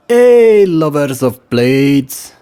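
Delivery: put-on loud, high, drawn-out trader's greeting in a West Frankish accent. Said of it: "greeted with a West Frankish accent".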